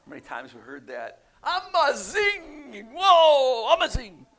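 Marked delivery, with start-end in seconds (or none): 1.4-4.3 Amazing drawn out high and loud in a hammed-up Italian-South African accent. Shown as a demonstration. Pastor jokingly imitating South Africans to the congregation, loud hammed-up Italian-tinged accent. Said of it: "South African with an Italian accent".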